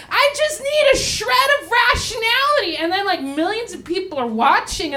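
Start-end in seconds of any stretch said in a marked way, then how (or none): 0.1-2.8 desperate. Retold or imitated. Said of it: "she's like".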